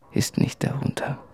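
soft whispered ending; hushed. Acted. Letter read, final words.

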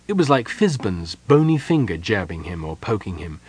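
plain reading voice

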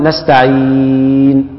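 نستعين with the ي of عين drawn out over four counts; four-count hold. Shown as a demonstration. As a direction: stretch the vowel in نستعين for four beats, slow. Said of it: "مد أربع حركات بالطريقة دي".

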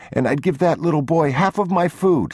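put-on high, insistent child voice; pleading little boy. A narrator's reading. Boy offering his food to the other child, high pleading. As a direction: bargain desperately in a high pitch.

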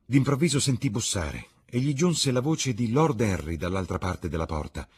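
usual speaking voice

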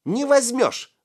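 high, loud defiance; shrill-pitched, fiery retort; hot-headed shout. A narrator's reading. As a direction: cry out hotly like a small boy. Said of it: "запальчиво выкрикнул мышь".

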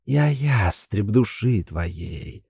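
very slow, low, soft and creeping; rustling insinuating voice. Acted. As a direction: coax it out like rustling leaves. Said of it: "шелестящий вкрадчивый голос". (шелестящий вкрадчивый голос)

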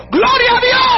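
high, ringing shout of praise; sudden exultant holler; preacher's praise shout. Shown as a demonstration. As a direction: burst out shouting mid-sentence. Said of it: "Ese es un gritón".

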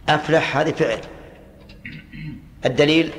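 ordinary delivery

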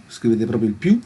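normal talking voice

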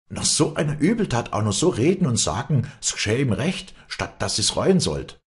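high, pressing voices of an outraged crowd; indignant outcry. A narrator's reading.

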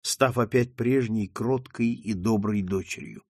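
ordinary delivery, nothing marked

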